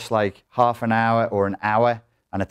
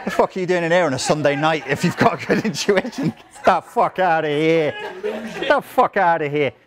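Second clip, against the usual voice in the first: high-pitched comic indignation; mock outrage; 7 semitones higher than usual. Speaker teasing the audience for claiming good intuition, mock outraged. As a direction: throw them out in high-pitched mock disbelief.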